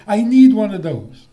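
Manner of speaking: put-on loud, whiny child wanting a toy; mock child pleading for a toy; demanding child. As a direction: belt it like a greedy kid. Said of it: "So a child says".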